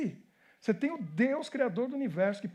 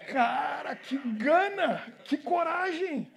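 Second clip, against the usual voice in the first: higher, drawn-out marvelling; real admiration; 5 semitones higher, much slower than usual. Real, not acted. Preacher to congregation about brave David, slow admiring exclamation. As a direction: stretch it out in awe of such nerve.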